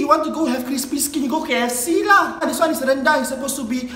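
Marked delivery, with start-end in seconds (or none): none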